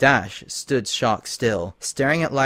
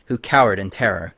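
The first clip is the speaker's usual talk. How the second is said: ordinary delivery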